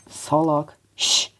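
ordinary delivery

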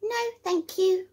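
high, small creature voice; squeaky little critter saying no. A narrator's reading. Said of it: "replies the little creature".

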